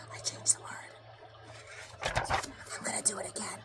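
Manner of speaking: unvoiced whisper off-script; whispered aside. Real, not acted. Reader whispering an aside mid-story. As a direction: murmur a quick aside. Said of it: "aside to listener".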